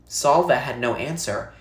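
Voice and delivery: usual speaking voice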